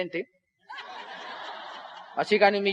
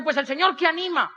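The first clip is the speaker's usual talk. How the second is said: higher-pitched, mildly exasperated reply; annoyed organizer; 6 semitones higher than usual. Retold or imitated. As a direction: answer as the upset organizer. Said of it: "por qué está tan brava".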